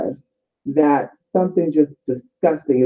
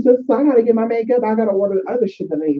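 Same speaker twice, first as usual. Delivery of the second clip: ordinary talk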